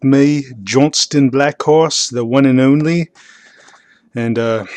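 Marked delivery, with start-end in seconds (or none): 0.0-3.1 "Johnston Blackhorse, the one and only" in a slow, hammed-up Australian accent; self-introduction in a bad Australian accent. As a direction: introduce yourself like a cheesy Australian host, drawing out "Blackhorse". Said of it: "that bad Australian accent".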